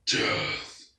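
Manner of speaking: a lightly voiced, unvoiced growled Death; menacing robot. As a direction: breathe the word like a cold killer. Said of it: "he just says".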